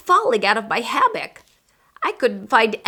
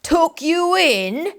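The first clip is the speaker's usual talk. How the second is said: slow, higher incredulous pirate; slow, scornful captain, higher; bellow; 8 semitones higher, much slower than usual. Acted. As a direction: scoff at the very idea. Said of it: "he bellowed".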